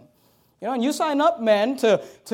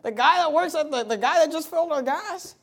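mock-incredulous, pitched up; amused disbelief; 6 semitones higher than usual. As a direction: imitate a husband scoffing in amusement. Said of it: "he's just laughing about it".

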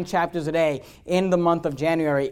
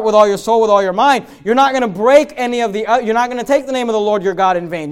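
ordinary talk

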